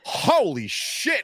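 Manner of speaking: high, very slow, drawn-out shock; stunned holy shit. Retold or imitated. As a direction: stretch it out in disbelief. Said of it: "and I'm like".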